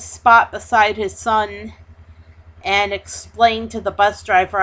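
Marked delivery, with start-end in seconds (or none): none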